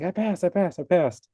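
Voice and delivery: high, thrilled repeated cheer; mock giddy squeal of joy; giddy excitement. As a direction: imitate a giddy girl celebrating. Girl who passed, retold.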